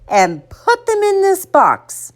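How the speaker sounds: slow, high motherly command; mother laying down the law; ordering. A narrator's reading. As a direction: spell out the order slowly, pitched high. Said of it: "she ordered".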